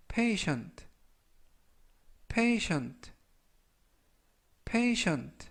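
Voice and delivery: "patient" said as PAY-shunt; clear, careful "patient" for learners; pronunciation model. Shown as a demonstration. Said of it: "발음하시면 되겠고요".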